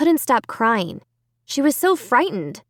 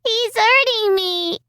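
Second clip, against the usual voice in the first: put-on high, slow frightened child's cry; a little girl crying out in pain, drawn out high; 12 semitones higher, slower than usual. A narrator's reading. Little girl telling her mother she is hurt, high frightened cry. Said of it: "Alicia wailed".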